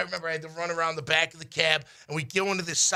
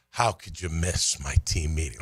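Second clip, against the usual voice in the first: low, menacing coach voice; stern coach; 11 semitones lower than usual. Retold or imitated. Coach confronting player over missed meeting, stern. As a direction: drop far down in pitch for a retold scolding.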